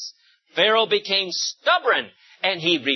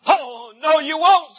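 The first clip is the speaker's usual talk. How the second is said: higher, louder angry refusal; angry king; 8 semitones higher, 5 dB louder, slower than usual. A narrator's reading. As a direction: snap back as an angry king. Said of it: "said the angry king".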